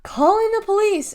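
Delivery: high, loud angry yell. A narrator's reading. Cashier threatens the spitting kids through glass, yelling. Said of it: "Norma was yelling now".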